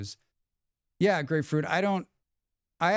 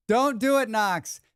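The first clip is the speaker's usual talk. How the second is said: louder and higher, teasing don't; playful warning; 8 semitones higher, 4 dB louder than usual. Real, not acted. Streamer warning a chatter off a pun, playfully louder. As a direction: call it out like a mock alarm. Warns a viewer off a joke.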